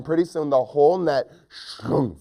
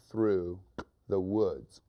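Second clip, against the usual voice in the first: ordinary delivery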